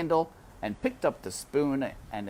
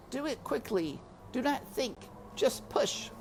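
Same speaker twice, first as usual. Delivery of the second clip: high, soft little mouse voice, urging himself on; 14 semitones higher, 3 dB quieter than usual. A narrator's reading. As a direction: egg yourself on, small and hushed. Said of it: "he said to himself".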